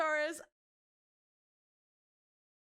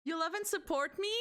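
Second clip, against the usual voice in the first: normal talking voice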